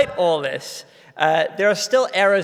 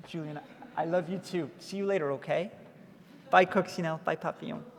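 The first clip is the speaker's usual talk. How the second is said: tender goodbye; 9 dB quieter than usual. Real, not acted. Saying goodbye to her children.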